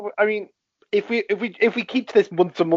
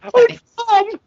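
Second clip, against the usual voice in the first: high, loud gushing fan voice; mock fangirl squeal; 6 dB louder, slower than usual. Co-host joins in as a Hiddleston fan, mock excited squeal. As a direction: squeal like a hammed-up star-struck fan.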